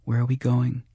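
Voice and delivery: lightly voiced anxious girl; young girl Angie. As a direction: ask it small and high. Frightened girl asks.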